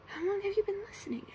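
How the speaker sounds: high-pitched goblin question; high storybook goblin voice. A narrator's reading. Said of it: "asked the goblin".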